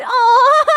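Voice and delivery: loud, high fond aw; high laughing aw. Real, not acted. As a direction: coo it through a laugh.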